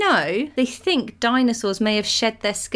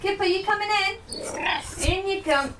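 calling out; 9 semitones higher, 5 dB quieter than usual. Real, not acted. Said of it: "I'll call him out the window".

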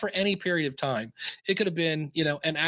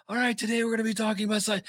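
higher, fast podcast-host intro; Doctor Dave's voice; 7 semitones higher, faster than usual. Retold or imitated. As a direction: rattle off the show opener in his voice. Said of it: "you hit play and you go".

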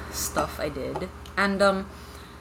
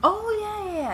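excited; 3 dB louder than usual. Real, not acted. Excited at seeing her old video.

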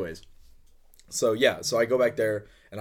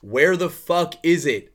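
louder, higher, drawn-out shout; retold yell; 7 semitones higher, 10 dB louder, much slower than usual. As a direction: imitate an irate chef hollering across the room. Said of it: "yelling across the brewery".

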